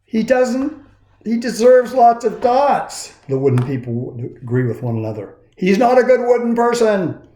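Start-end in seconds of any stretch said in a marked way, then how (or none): 0.0-3.1 high, sneering townsfolk; lightly voiced disapproving crowd; mocking gossip voice. A narrator's reading. Wooden people gossip about Punchinella. 5.6-7.3 high, sneering townsfolk; lightly voiced disapproving crowd; mocking gossip voice. A narrator's reading. Wooden people agree.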